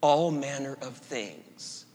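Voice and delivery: soft, slow, reverent reading of the mystic's words; hushed quoting. Acted. Preacher quoting Julian of Norwich to the congregation, hushed and reverent. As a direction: let each line settle quietly. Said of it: "And I quote".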